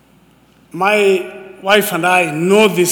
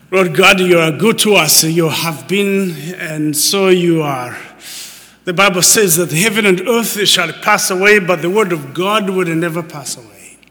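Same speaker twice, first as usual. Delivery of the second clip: prayer; 5 dB louder than usual. Acted. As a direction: pray it out with reverence. Opening prayer.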